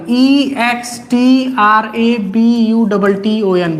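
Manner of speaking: E x T R A B U double T O N, each letter slow and separate; spelled out slowly. Shown as a demonstration. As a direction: spell extra button letter by letter, slowly for dictation. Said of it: "इसको कहते हैं extra button". (धीरे-धीरे एक-एक अक्षर बोलकर spelling)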